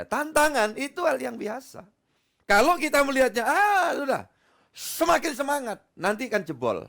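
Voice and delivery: rally the congregation against challenges; preaching. Acted. Pastor urging the church to face challenges, rousing.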